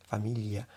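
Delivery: familia with the Italian gl, quiet. Shown as a demonstration. Teacher softly trying the Italian gl. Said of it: "palabra con G L en italiano".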